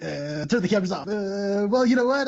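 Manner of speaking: high-pitched, flustered mock irritation; mock pissed off. Reporter to his crew after the empty vault, mock pissed off. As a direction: imitate a TV host fuming at a flop. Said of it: "really pissed off".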